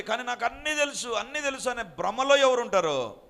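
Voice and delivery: loud, driving pulpit delivery; fiery preaching. Acted. Preacher thunders the verse at the congregation, loud and forceful. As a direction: preach it hard to the crowd.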